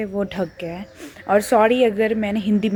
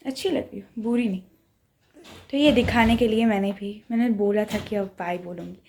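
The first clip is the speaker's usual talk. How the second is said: ordinary talk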